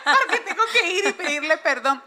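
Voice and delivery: half-laughing at her own stubbornness; giggling mock objection; laughing protest. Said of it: "Y yo dije".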